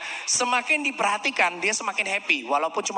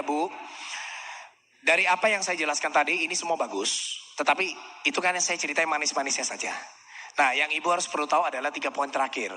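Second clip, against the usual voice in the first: calm matching tone; 5 semitones lower than usual. Shown as a demonstration. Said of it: "intonasinya sama seperti ini".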